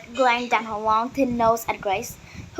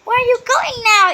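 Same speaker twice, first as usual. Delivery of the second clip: much higher, loud call after her; hollered; 12 semitones higher, 10 dB louder than usual. A narrator's reading. Izumi calling after Grace as she runs off, high and loud. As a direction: holler after someone running away. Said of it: "Izumi hollered after her".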